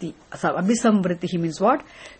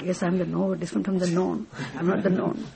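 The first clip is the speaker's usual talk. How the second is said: softer, meek answer; quiet reply; 7 dB quieter, faster than usual. Acted. Seeker answering scripture, quiet and meek.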